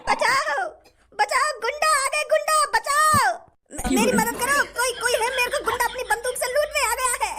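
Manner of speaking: high, frantic shriek for help; high panicked pleading, half-laughing; panicked cry for help. Acted. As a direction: scream for help like a terrified robbery victim. Victim screams as robber threatens.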